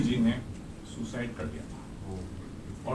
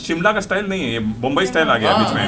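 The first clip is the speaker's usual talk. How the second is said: loud protest; 4 semitones higher, 8 dB louder than usual. Real, not acted. Objector protesting loudly.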